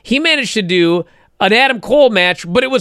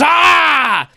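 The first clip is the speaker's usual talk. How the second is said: retold fighting roar; 9 semitones higher than usual. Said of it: "and he goes".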